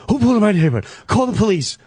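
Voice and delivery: high, loud alarmed wife; imitating a frightened wife on the phone; panicked wife. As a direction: shriek it like a startled wife.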